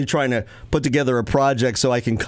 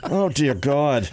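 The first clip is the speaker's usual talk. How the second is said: ordinary delivery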